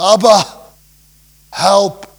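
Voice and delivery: loud, high cry for help; desperate cry. Shown as a demonstration. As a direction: call out to Abba as if in desperate need. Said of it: "It's a cry".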